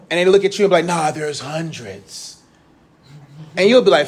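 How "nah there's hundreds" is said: slow, soft, drawn-out contradiction; mock dismissive non-believer. Teacher mimicking a non-believer arguing back, slow and dismissive. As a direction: stretch it out softly, mimicking a know-it-all.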